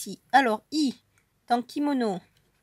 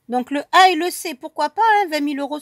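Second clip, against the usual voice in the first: excited hope; 3 semitones higher, 7 dB louder, faster than usual. Real, not acted. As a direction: let the hope jump out. Grattage, espoir de gagner vingt mille euros. (espoir soudain, plus aigu et plus fort)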